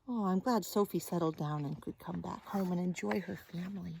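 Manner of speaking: plain reading voice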